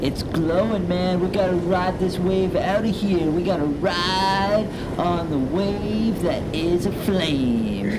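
loud laid-back surfer bro; surfer dude. Acted. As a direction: drawl it like a beach bro. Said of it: "the surfer voices".

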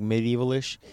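ordinary talk

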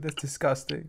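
ordinary talk, nothing marked

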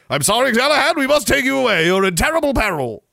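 loud, high mock-heroic urgency; rescuing knight. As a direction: insist like a hammed-up knight on a rescue.

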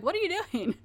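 a high frightened question, lightly acted; imitating a scared woman caught off guard; retold terrified cry. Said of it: "and she's terrified".

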